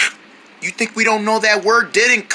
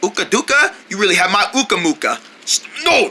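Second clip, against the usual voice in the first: normal talking voice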